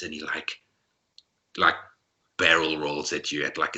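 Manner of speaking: usual speaking voice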